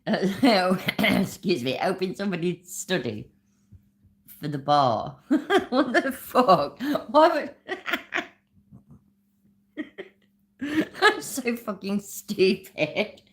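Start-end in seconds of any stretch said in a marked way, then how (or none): 5.2-13.2 real laughter breaking through, slowed; laughing at his own stumble; giggling, disbelieving curse. Real, not acted. Laughing at guest's slip.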